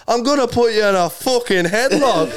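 high-pitched mock Irish threat; put-on Irish accent, laughing at the end. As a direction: imitate an Irish woman threatening someone, pitched right up. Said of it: "larger Irish chick gets up and she goes".

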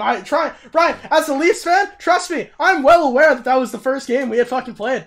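loud, high, exasperated rant; almost yelling in frustration at Ryan; heated rant. Real, not acted.

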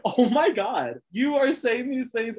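high, loud, slow gasp of disbelief; mock-scandalized, stretch it out; shocked disbelief. Podcaster reacting to a contestant's blunt confessional, scandalized. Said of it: "And it's like".

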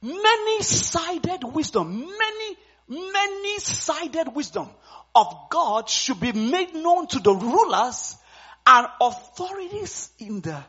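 loud, slow fiery preaching. Acted. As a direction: proclaim it from the pulpit. Preaching the scripture at full force.

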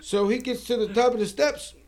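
ordinary delivery, nothing marked

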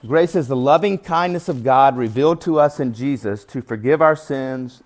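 ordinary talk

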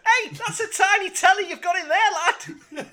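very high, loud Scouse marvelling; confused Scouse lad. Acted. Confused Scouse lad seeing small things, high and loud. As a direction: exclaim in exaggerated Scouse. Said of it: "confused Scouse lad".

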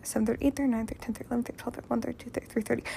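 hushed muttered tally; counting to herself. Real, not acted. Patient adding up hours from a morning surgery, muttered counting. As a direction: tick off the times under your breath.

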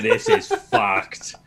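very high, very slow stunned drawl. Retold or imitated. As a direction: stretch it out in disbelief. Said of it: "I was like".

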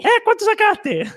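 high quavering ghost granny; ghostly old woman. Acted. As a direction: speak from beyond the grave as a grandmother. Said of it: "Una voz de ultratumba de una anciana".

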